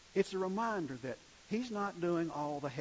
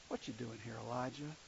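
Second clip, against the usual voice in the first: God asks Elijah quietly, much softer; soft, gentle tone; 7 semitones lower, 10 dB quieter than usual. Shown as a demonstration. God gently questioning the weary prophet, soft and hushed. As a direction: ask it softly, like a still small voice. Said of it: "I think that's probably about the tone".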